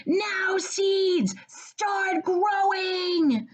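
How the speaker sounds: high, loud, very slow shout. Acted. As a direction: bellow it at the ground, stretched out. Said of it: "and shouted".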